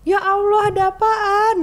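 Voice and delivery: high, loud gasp of concern; mother shocked, asking what happened; alarmed mother. Retold or imitated. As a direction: cry out in worry.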